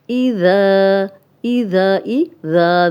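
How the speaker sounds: اِذَا with a soft ذ and a long alif; slow tajweed drill; soft dhal. Shown as a demonstration. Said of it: "ذال نرم ہے".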